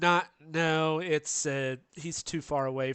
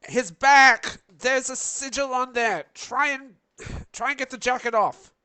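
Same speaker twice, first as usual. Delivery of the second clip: shouting; 9 semitones higher, 7 dB louder than usual. Acted. Shouting warning to party in-game.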